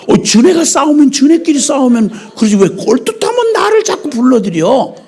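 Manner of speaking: high, loud indignant whine; mock animal complaint. Preacher voicing animals who hate being dragged into curses, indignant. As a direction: play the offended animals protesting loudly.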